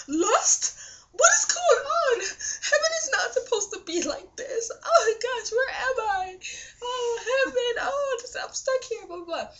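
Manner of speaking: high, teary mock panic, half laughing. Retelling being stuck in heaven, high mock crying panic. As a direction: wail it high like a lost kid, half joking.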